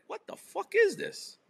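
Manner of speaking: like seeing an outrageous bill; shocked outburst. Retold or imitated. Husband seeing the charge on his Amex, shocked disbelief. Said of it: "I was like".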